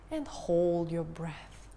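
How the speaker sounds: slow whispered instruction; whispering. Acted. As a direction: whisper the hold, slowly. Breathing count.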